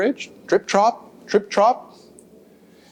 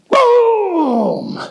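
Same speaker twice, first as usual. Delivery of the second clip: high, loud exclaimed boom; big boom; 14 semitones higher, 4 dB louder than usual. Acted. Narrator calling the collision, loud and high. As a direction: burst it out.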